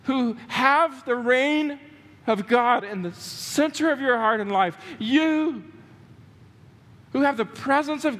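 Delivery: lifted, impassioned preaching voice; voicing Jesus. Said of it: "He is saying".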